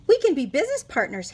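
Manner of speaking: quick salesman patter; fast eager pitch. Acted. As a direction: rush the offer eagerly.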